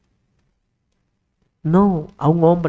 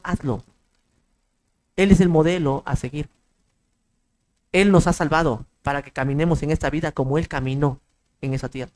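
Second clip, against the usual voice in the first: normal talking voice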